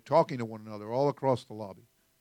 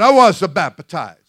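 put-on Jamaican accent; 6 semitones higher, 17 dB louder than usual. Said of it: "Got that accent going on there".

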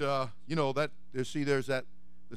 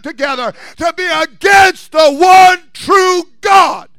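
loud, high, shouting sermon build; full-force preaching; 12 semitones higher, 17 dB louder, slower than usual. Acted. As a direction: preach it hard to the congregation.

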